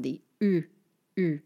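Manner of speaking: "U" as the tight front French vowel; French U sound. Shown as a demonstration. Teacher shows Anglophones the hardest sound, isolated French "U". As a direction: say "U" as French y, not English "you". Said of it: "sound that's the hardest for Anglophones".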